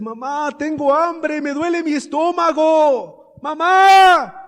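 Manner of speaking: put-on high, loud plea of a hungry girl; imitated child crying out. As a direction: build from a plea to a shouted call for mother. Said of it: "la niña otra vez le dice".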